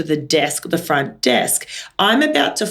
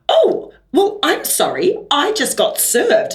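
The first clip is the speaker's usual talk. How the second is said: mock-innocent rude lady, pitched up high; snooty customer; 8 semitones higher, slower than usual. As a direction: play the affronted queue-jumper. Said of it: "And she's like".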